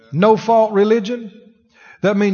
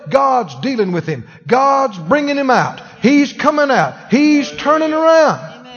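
declaring faith; 6 semitones higher, 4 dB louder than usual. Retold or imitated. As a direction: proclaim each line stronger. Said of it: "we just begin to say".

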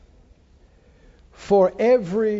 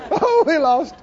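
higher-pitched mock wail amid laughter; mock moaning; 8 semitones higher, 3 dB louder than usual. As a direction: moan in pretend grief. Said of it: "you wouldn't moan and go".

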